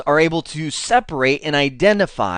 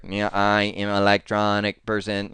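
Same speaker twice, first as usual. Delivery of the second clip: low, soft robotic monotone; 5 semitones lower, 4 dB quieter than usual. Retold or imitated. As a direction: speak like a glitchy robot. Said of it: "where you start sounding like a robot".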